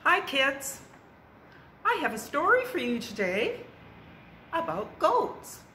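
bright, high storytime greeting. Acted. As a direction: greet the kids like a children's librarian. Reading to children.

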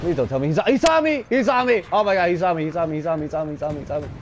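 starts very high and loud, eases down as it repeats; frightened babbling after being spotted; panicked, then fading. Real, not acted. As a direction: blurt it in rising then settling panic. Spotted by monster.

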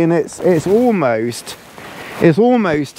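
usual speaking voice

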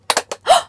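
sharp high gasp of surprise; big theatrical gasp; surprised gasp. Acted. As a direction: gasp as if startled. Toy pops open.

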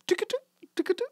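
high, fast mock ringing noise; imitated phone ring. To his friend, mimicking the old phone booth, playful high ring. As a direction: chirp it out like an old telephone ringing.

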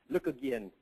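lightly attempted Australian accent; Aussie accent. Quoting a colleague who challenged him, imperfect Aussie accent. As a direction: have a rough go at an Aussie accent. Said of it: "a bit of an Aussie accent".

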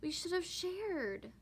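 slow, high, a touch sorry; mewed sadly. Acted. Kitten Spotty sadly regretting the smashed egg, mild. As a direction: let a mild regret soften it. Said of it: "Spotty mewed sadly".

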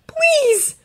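high, pleading cry; begging. A narrator's reading. Boy to the sleeping dragon, begging. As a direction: plead with everything you have. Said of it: "he begs for Mizuchi's sake".

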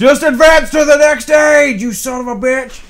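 angry fans yelling at the streamer; viewers shouting. Retold or imitated. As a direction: yell it like a frustrated viewer. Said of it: "all of you have been shouting at me".

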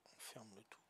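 softly whispered repeat. Real, not acted. Player echoing the teammate's instruction in a whisper. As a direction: breathe it out under his breath.

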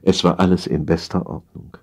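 ordinary delivery, nothing marked

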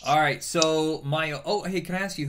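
ordinary talk

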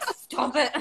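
mock-scolding through a laugh; laughing stop it. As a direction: scold yourself while laughing. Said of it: "if I get emotional".

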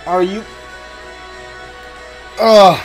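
ordinary delivery

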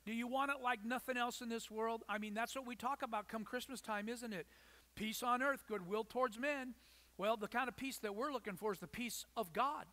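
usual speaking voice

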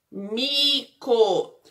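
"Mico" as two separate syllables, mi, co; split into syllables. Shown as a demonstration. Teacher sounding out the word for pupils, "Mico" syllable by syllable. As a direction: leave a clear gap inside "Mico". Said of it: "ele começa com a sílaba".

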